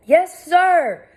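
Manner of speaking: high loud distant reply; calling back. A narrator's reading. Constable calling back to the inspector. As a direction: answer from up the path. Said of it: "called someone farther up the path".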